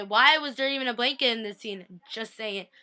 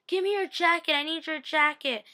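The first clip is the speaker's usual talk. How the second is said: higher-pitched mock pleading; acting out a hurried demand, pitched up; urgent demand; 5 semitones higher than usual. Caroline at the door asking for his jacket, urgent demand.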